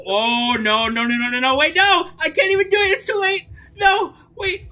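panicked yelling. Real, not acted. Gamer panicking to her duo as she loses. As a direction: yell no as the play falls apart. Panicking as the match goes wrong.

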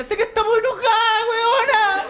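very high, loud squealing friend voice; gushing girlfriend. Retold or imitated. Estranged friend calls to offer support, very high squealing voice. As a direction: squeal it like a friend pushing a party. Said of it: "La llama hasta la que estaba enojada".

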